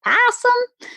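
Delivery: sudden high-pitched squeak; high squeaky voice. Pretend. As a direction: squeak the word Possum up high. Playful word repeated.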